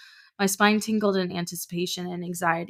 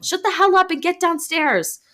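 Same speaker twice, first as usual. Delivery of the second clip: furious scream; 12 semitones higher, 7 dB louder than usual. Acted. Mother screaming at child.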